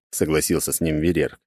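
usual speaking voice

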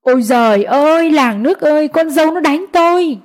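mock-hysterical lament to draw neighbours; loud wailing. Mother-in-law wails to the neighbours that she was hit, loud lament. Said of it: "la om sòm".

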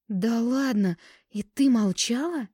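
high-pitched, drawn-out disbelief; incredulous. A narrator's reading. Liza hears her friend kept the proposal secret, incredulous. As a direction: react amazed at the secret, voice swinging up.

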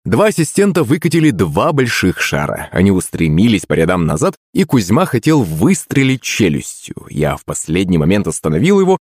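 plain reading voice

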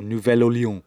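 French accent on Nouvelle-Orléans; French pronunciation. Shown as a demonstration. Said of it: "as they call it".

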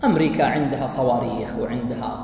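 a hint of a pundit listing America's weapons; understated commentator voice; quoted analyst. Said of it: "يقول".

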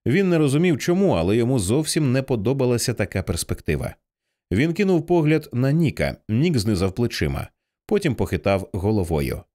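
ordinary delivery

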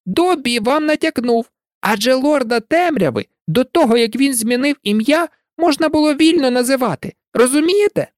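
high elf voice, eager to be understood; eager house-elf. A narrator's reading. Dobby explaining the hint to Harry, eager. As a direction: point out the hint keenly.

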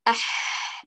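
أح breathed with a slight rasp; correct ha friction. Shown as a demonstration. Teacher showing the student the correct ح, breathy but rasped. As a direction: say أح with audible friction in the throat. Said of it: "لابد أن تقولي".

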